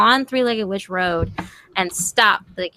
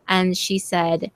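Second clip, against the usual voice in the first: ordinary talk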